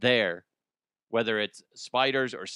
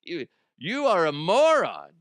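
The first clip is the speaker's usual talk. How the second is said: mock scolding; 5 semitones higher, 5 dB louder than usual. Mocking the imagined person.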